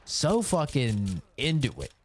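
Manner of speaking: ordinary talk, nothing marked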